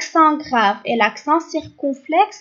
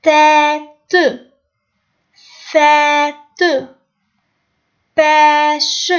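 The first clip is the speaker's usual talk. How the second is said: slow, firm word-by-word reading; model reading; 5 dB louder, much slower than usual. Shown as a demonstration. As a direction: read each word aloud for pupils, stretched and louder.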